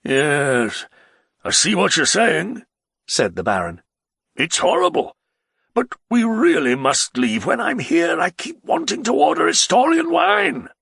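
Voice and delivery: a tipsy nobleman begging to ride away; the Baron, pleading. Acted. Baron speaks.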